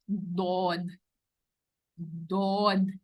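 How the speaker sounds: دود with the tongue sides on the palate, heavy; heavy dwad. Shown as a demonstration. Teacher demonstrating the hard ض to the class. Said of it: "heavy کر کے بولیں گے".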